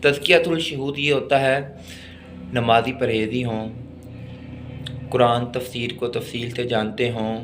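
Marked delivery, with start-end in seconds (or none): none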